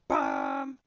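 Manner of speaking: a low, loud, sudden bam. Shown as a demonstration. Teacher to student, sforzando shown as a loud bam. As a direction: hit it like you want to scare somebody. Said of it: "all of a sudden you go".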